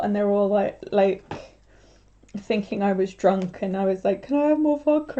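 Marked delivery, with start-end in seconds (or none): 4.2-5.2 higher-pitched wheedling request; mock plea. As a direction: plead for it in a high voice. Drunk-seeming plea retold from floor.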